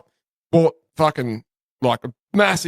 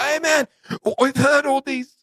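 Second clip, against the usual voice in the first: much higher-pitched Japanese tourist trying to sound Aussie; Japanese tourist's Aussie accent; 10 semitones higher than usual. Retold or imitated. Imitating tourists greeting him, clumsy Japanese-Aussie accent. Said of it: "they were full Aussie as Japanese".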